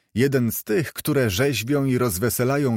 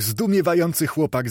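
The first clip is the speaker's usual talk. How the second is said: plain reading voice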